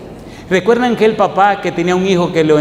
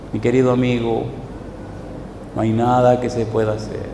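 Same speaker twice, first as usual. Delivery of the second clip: mild sorrowful doctor; 4 semitones lower, 6 dB quieter than usual. Retold or imitated. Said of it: "con los ojos de, llenos de lágrimas".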